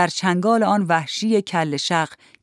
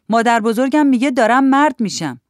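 put-on high, loud childish boast; boasting little boy; 6 semitones higher, 6 dB louder than usual. A narrator's reading. Boy quoting grandmother to older kids, loud boast. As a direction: announce it proudly in a boy's raised voice.

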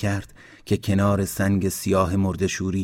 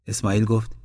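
ordinary delivery

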